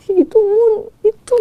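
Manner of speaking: crying as she says it, pitch high; real tears breaking through the words; choked-up sobbing voice. Real, not acted. Mother breaks down recalling dream.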